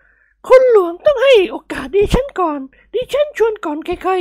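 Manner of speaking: very high falsetto matron, insistent; plump middle-aged lady. Acted. Lady demanding first turn from the manager, insistent falsetto. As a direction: protest in a loud high woman's voice. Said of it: "สุภาพสตรีในวัยกลางคนร่างอ้วนตุ๊คนหนึ่งกล่าวขึ้น".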